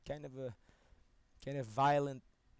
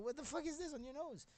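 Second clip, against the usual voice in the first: mock shock. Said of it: "open the door".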